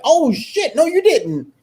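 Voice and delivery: high squeal of disbelief; shocked reaction. Retold or imitated. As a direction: act out your own jaw-drop. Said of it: "I'm like".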